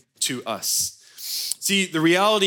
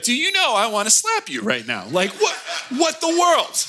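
laughing, higher mock outrage; half-laughing what-the-world; mock exasperation; 8 semitones higher than usual. Pastor telling student what he wished to say, laughing outrage. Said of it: "I just wanna be like".

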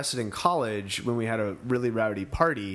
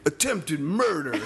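slow, higher, laughing boast; deadpan brag cracking into a laugh; cellmate's boast; 4 semitones higher, slower than usual. Retold or imitated. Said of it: "He's like".

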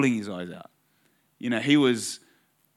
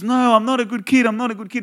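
imitated tearful kid, much higher; crying protest; 9 semitones higher, 5 dB louder, faster than usual. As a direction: protest in a high voice like an upset child. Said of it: "He's crying".

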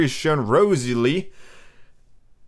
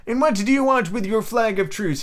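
crying out; 7 semitones higher than usual. A narrator's reading. Captain questioning the mutineers, mild cry. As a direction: raise the pitch as if calling. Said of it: "he cried".

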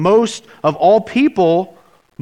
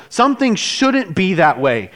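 ordinary talk, nothing marked

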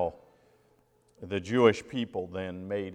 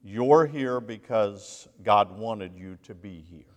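louder, slow, full-force preaching; 8 dB louder, slower than usual. Acted. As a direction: lean in and drive each phrase home slowly.

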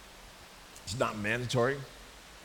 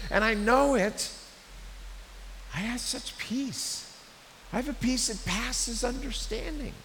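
high-pitched, impassioned assurance; earnest testimony; 8 semitones higher than usual. Acted. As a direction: plead earnestly with them about what you have. Voices Paul testifying to his peace.

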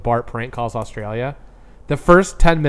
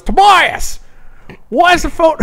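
very high, loud mock yell; cartoon kid's dad; 6 dB louder, slower than usual. Host imitating the dad seeing the bill, loud high yell. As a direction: holler for the kid as an outraged cartoon dad. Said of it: "the kid's dad".

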